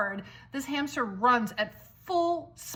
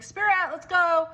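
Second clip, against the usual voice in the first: loud, high playground call; 7 semitones higher, 6 dB louder than usual. Retold or imitated. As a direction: call your kid across the park. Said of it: "every time I yelled for her".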